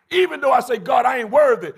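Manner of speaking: loud, high cry of unworthiness; shouted plea. Acted. Preacher crying out to God that he is not worthy.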